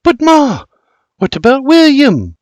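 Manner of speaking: change the subject eagerly; young lass asking. Acted. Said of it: "Flora asks".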